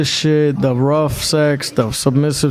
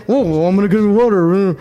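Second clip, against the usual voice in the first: put-on grumbling imitation, words slurred together; mock her grudging compliance in a put-on voice; mocking stewardess; 3 semitones higher than usual. Stewardess giving in to complaining passengers, mockingly imitated.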